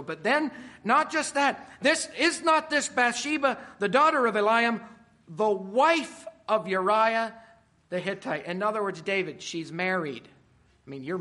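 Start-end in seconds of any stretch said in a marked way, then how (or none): 0.0-8.5 loud, high, every name hammered; emphatic reading. Shown as a demonstration. Preacher pressing the servants' question on the congregation, loud and high. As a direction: stress each detail like a warning. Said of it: "underline all of that".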